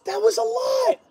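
high disbelieving squeak; squeaky repeat. Real, not acted. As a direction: squeak it out, overwhelmed.

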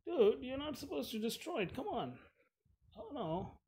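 very high-pitched, exasperated whine; mock-whiny protest. Real, not acted. Player scolding zombie for destroying it, high whiny protest.

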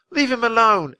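high, loud shout; yelling. Acted. Annie yells at the strange pair, high and loud. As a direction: yell at the strangers to back off. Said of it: "Annie yelled".

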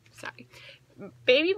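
ordinary delivery

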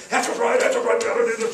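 loud, high, rapid-fire agreement; imitating D'Amato's outburst. Colleague jumping up in committee, loud excited agreement. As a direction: blurt it out like an eager senator. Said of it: "He was going on and on like that".

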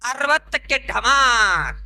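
very slow, last word stretched out; comic slow-motion punchline; drawn-out punchline. Acted. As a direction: stretch the final word into a long crash. Punchline of rhymed age list.

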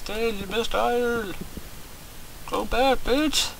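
playing a viewer scolding him for missing iron; mock yelling viewers. Viewers yelling at the streamer over missed iron, high and slow mock yell. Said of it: "screaming at me right now".

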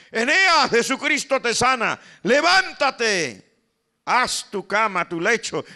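loud, high, ringing command, levántate stretched slow; commanding shout. Acted. Peter commanding the paralyzed man to rise: loud ringing command. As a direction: call him up off the bed with full force.

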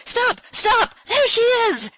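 very high, loud scream; piercing, urgent yell; roared. A narrator's reading. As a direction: shout frantically to halt the coach. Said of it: "he roared".

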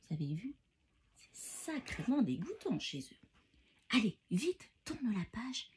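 ordinary delivery

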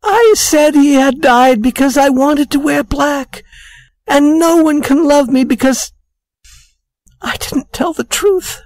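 high-pitched, weepy woman's confession; tearful. A narrator's reading. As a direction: confess through acted tears, voice pushed way up. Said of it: "began to cry".

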